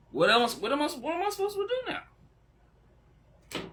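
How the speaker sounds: high, slow, breaking voice; tearful wail. Real, not acted. Streamer asking what comes next, high tearful wail. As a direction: wail it out, lost and emotional. Overwhelmed, crying.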